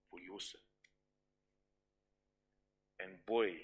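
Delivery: put-on hushed, soft and gentle, as God to his child; God speaking tenderly. Preacher voicing God to the congregation, softly and tenderly.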